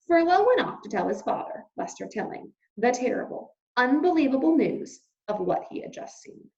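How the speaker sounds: ordinary delivery, nothing marked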